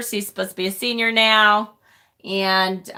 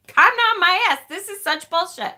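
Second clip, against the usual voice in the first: high-pitched indignant comeback; pissed-off retort; 9 semitones higher than usual. Retold or imitated. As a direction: retell snapping back at a calming husband. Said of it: "I was so pissed".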